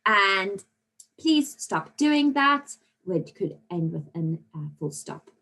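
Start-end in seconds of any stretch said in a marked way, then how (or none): 1.2-2.8 slow, higher, louder demonstrated command; urgent command. Shown as a demonstration. Teacher reading out an example command to pupils, urgent. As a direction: spell out the order slowly and firmly. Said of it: "they've generally got this urgent".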